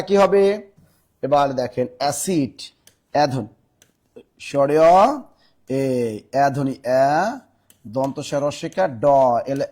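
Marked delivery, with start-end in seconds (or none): none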